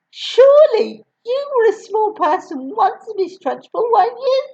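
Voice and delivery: put-on high, timid child's voice; brave little boy. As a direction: pipe up like a small boy. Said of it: "spoke up and said".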